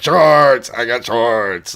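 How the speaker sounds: loud, high, gleeful yell of shorts; excited shout. Retold or imitated. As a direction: shout it like a thrilled kid. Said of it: "I was like".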